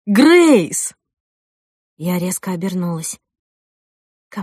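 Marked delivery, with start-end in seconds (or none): 0.1-0.9 loud, high call of a name; urgent raised shout. Acted. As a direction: call out to someone across a room. Jack running toward her, calls out.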